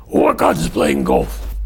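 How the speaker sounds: low, slow, loud Scottish reply; Scottish accent. Acted. McDougall explaining his lost voice, Scottish accent. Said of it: "my Scottish accent".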